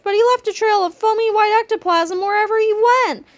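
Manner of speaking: high, fearful declaration; scared cry. A narrator's reading. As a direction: cry it out, spooked. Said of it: "cried George".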